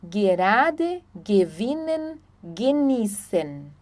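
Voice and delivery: "gerade", "gewinnen", "genießen" with hard G as in "gui". Shown as a demonstration. Teacher explaining German G, hard G in "gerade", "gewinnen", "genießen". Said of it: "nunca vai ser G".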